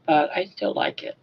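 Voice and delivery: ordinary talk, nothing marked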